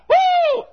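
high joyful whoop; excited stadium whoop. Retold or imitated. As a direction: cheer like a player who just scored. Football player celebrates touchdown.

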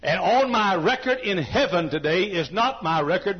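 ordinary talk

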